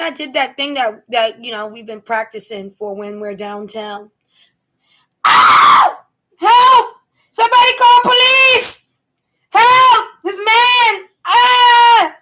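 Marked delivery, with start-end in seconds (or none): 5.2-12.2 loud, high-pitched demonstration scream; full-volume staged cries for police; screaming for help. Shown as a demonstration. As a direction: yell for help like you're being attacked. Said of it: "'Cause I will scream like that".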